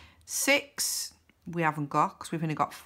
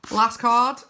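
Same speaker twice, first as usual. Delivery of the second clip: raised, punchy call; loud announcement; 3 semitones higher, 6 dB louder than usual. Real, not acted. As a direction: announce the final card out loud. Announces the final card.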